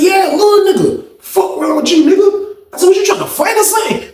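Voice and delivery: high-pitched heated retort; re-enacted angry challenge, very high pitch; confrontational challenge. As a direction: square up for a fight, voice shooting up. Acted quarrel with the nephew.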